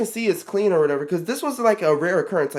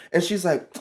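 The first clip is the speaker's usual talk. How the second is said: ordinary talk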